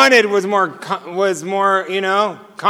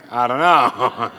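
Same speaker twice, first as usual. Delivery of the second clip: laughing; 8 semitones lower, 4 dB quieter, slower than usual. Retold or imitated. Clueless man answering every question.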